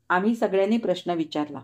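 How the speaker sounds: ordinary delivery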